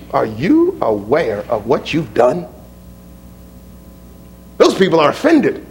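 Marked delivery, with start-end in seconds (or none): 0.1-2.4 lower, softer worried disciple; low, hushed Peter. Acted. Peter warning Jesus quietly, low hushed worry. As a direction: murmur it like a nervous aide.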